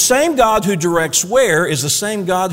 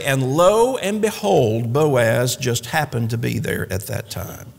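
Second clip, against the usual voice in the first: Southern storyteller; 5 semitones lower, slower than usual. Shown as a demonstration. Preacher showing how Southerners grab a listener, slow Southern drawl. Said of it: "If you really want to get their attention".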